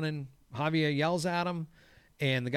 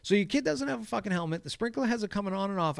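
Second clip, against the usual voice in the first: high-pitched, quick rebuke; retold arguing; 7 semitones higher, faster than usual. Groundskeeper warning a dad about sprinklers, retold arguing. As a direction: rattle off the warning as in a quarrel.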